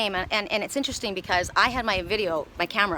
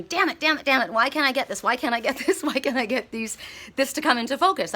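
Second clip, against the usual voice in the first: frustrated cursing; 5 semitones higher than usual. Retold or imitated. Can't get the cranes in focus, high frustrated cursing. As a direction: curse at the blurry camera in mock exasperation. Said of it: "I was so mad".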